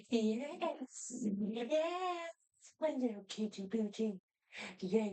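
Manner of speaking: high, soft cooing pet voice; baby talk to a dog. Acted. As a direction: coo to the puppy like a baby.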